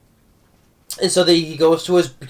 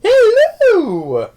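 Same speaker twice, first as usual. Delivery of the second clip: put-on much higher, louder old lady falsetto Hello; Mrs Doubtfire falsetto; 7 dB louder than usual. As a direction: sing out Hello in a high granny falsetto. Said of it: "that's the famous scene".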